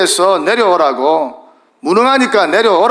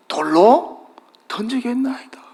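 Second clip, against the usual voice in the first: fearful whisper; 4 semitones higher, 4 dB quieter than usual. Retold or imitated. Moses fearfully pleads with God.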